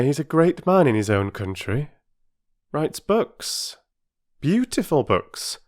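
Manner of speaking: proud of the stranger; kind Mother, bright. Acted.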